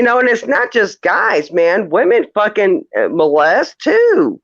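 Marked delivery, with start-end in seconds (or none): none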